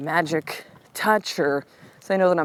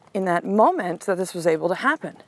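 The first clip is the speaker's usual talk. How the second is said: usual speaking voice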